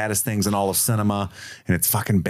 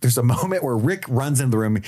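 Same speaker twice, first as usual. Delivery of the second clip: ordinary talk, nothing marked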